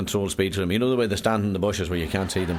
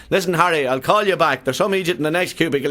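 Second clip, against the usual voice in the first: loud phone call; 7 semitones higher, 6 dB louder than usual. Acted. Man on the phone in a cubicle, loud and high. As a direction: raise the voice higher and louder as if talking into a phone.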